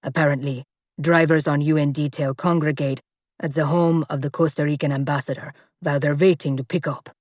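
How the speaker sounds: low, clipped report; grim bodyguard. Acted. Bodyguard reporting the stranded car, low and grim. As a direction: report the facts without emotion.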